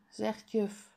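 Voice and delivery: ordinary delivery, nothing marked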